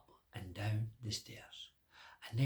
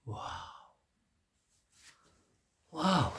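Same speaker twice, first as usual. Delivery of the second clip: amazed wow; 4 semitones higher, 4 dB louder than usual. Acted. King amazed at strong man.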